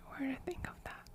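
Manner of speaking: normal talking voice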